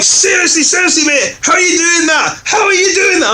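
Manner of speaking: very high, shouted disbelief; astonished, demanding an answer; amazed shout. Retold or imitated. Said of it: "he's like".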